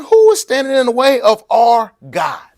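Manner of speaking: high and loud outraged cry; passionate outcry. Retold or imitated. Preacher voicing David defending God, loud and fired up. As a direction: shout it as if the giant insulted your God. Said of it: "He's saying".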